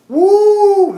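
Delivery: high, loud drawn-out "woo"; stunned whoop. Retold or imitated. Reliving a first punch from a grown man, high stunned whoop. Acts reaction to being punched.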